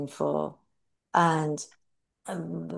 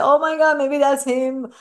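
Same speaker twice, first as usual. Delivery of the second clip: higher, louder imitated gasp of hope; retold astonishment; 7 semitones higher, 5 dB louder than usual. Grieving mother spotting a monk walking, retold astonishment. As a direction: act out sudden hopeful recognition, pitched up. Retelling mistaking a monk for him.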